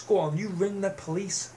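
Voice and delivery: ordinary talk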